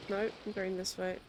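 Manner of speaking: usual speaking voice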